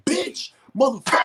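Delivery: imitating a high-pitched fighting yell; retold angry yell. Retelling a neighbors' fight overheard, imitated angry yell. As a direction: mimic someone yelling curses, pitch jumping way up. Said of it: "I just hear somebody yelling".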